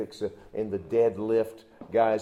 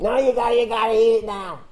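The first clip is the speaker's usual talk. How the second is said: put-on high, eager kid insisting; insistent kid; 10 semitones higher, 7 dB louder, faster than usual. Junior high boy insisting the pastor eat the candy, high and eager. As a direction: push it like a pushy little boy.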